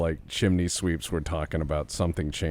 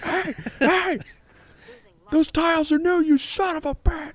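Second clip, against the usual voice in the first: mock British shout; 4 dB louder than usual. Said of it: "They're like".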